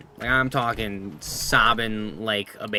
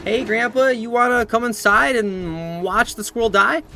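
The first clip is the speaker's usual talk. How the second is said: high, loud, over-eager mock invite; mock-cheerful invitation; 9 semitones higher, 5 dB louder, slower than usual. Inviting grandpa to watch the squirrel die, hammed-up cheer. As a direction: stretch it out with absurd enthusiasm.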